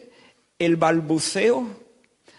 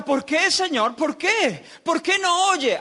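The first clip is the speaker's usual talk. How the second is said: higher, mock-pleading why; mimicked plea; 10 semitones higher than usual. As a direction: lightly imitate the complaining worshipper. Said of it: "El que pregunta". (imitación leve de una súplica, más aguda)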